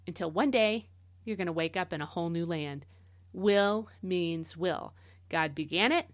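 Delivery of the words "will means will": "will" drawn out slowly at both ends; slow, deliberate stress on "will"; emphasis on will. Shown as a demonstration. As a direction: stretch and weight each "will". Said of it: "extra emphasis on the word will".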